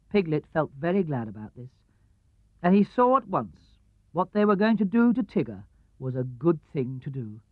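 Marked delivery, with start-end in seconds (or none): none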